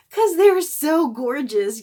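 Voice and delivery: swooning emphasis on so gorgeous; excited gush. Real, not acted. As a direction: gush about how gorgeous they are. Gushing over palettes.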